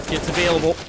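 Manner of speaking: high, animated round-ending commentary; excited play-by-play. Real, not acted. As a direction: wrap up the half with live-caster energy. Live play-by-play, half ends.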